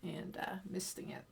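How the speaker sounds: soft whisper. Real, not acted. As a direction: whisper it under your breath. Quiet aside while misting sponge.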